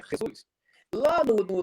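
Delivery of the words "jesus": "Jesus" said the Spanish way, opening on an H sound; "Jesus" with a Spanish breathy J, like H; Spanish pronunciation. Shown as a demonstration. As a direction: say "Jesus" as "heh-SOOS". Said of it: "No espanhol é".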